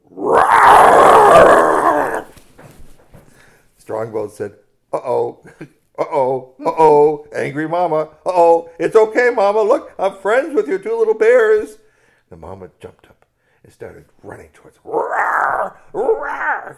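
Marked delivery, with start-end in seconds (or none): 0.1-2.2 huge, high, loud mock roar; bear roar. Mother bear roars at him. 4.9-11.8 rising higher and louder, nervous pleading; panicky uh-ohs. Acted. Strongbones panics before angry bear. 14.9-16.8 high, loud mock roaring; charging bear roar.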